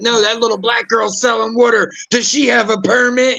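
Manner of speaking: high, shouted mock Karen outrage; imitating a Karen. Karen complaining about a girl selling water, shrill outrage. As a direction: play the busybody demanding a permit.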